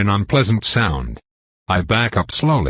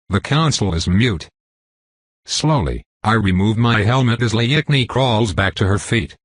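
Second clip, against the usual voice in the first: ordinary delivery, nothing marked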